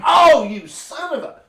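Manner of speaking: high, loud startled cry of pain; a hammed-up outraged squeal; mock outraged yelp. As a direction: yelp like you just got kicked in the shin. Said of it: "Now you're like".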